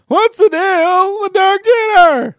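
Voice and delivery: hammed-up, high, loud Jerry Seinfeld; Seinfeld impression. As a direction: stretch it out like a Seinfeld bit. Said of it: "Terrible Seinfeld impressions".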